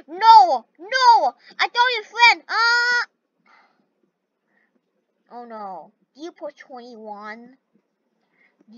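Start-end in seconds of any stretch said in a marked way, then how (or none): 0.1-3.1 high, loud distressed cries. Real, not acted. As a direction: cry out in dismay. Friend lost in game.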